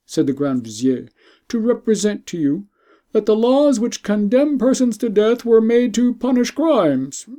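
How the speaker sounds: a grand vizier pleading for the queen's life. Acted. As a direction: beg the emperor for mercy, respectfully.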